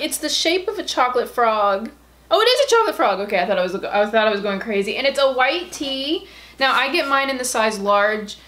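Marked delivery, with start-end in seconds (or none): 2.3-3.2 high, thrilled realisation; excited realisation. Real, not acted. Realising to viewers it really is a frog. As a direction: realise it with glee.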